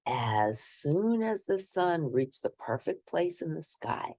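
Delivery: ordinary delivery, nothing marked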